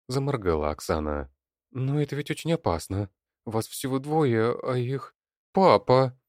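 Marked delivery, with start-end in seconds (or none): 1.7-5.1 higher, anxious woman's voice; worried plea. A narrator's reading. Wife warns Sergei of the danger, anxious. 5.5-6.2 loud, high cry of a daughter; exclaiming. A narrator's reading. Daughter exclaims to her father, loud and urgent. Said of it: "воскликнула Алёна".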